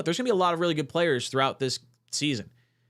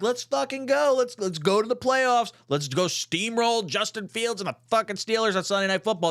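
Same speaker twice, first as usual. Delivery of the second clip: hyped-up fan; 6 semitones higher than usual. Retold or imitated. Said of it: "you're like".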